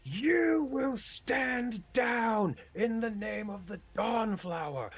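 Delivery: Demirius. Acted. Priest chastising wayward dwarves through a magical bullhorn, furious booming rebuke. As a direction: lay into them like a drill sergeant. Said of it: "his voice booming".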